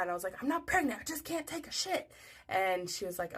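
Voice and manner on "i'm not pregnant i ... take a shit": high-pitched mad retort; indignant outburst. Retold or imitated. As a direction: protest in a high, exasperated voice. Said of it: "I got really mad".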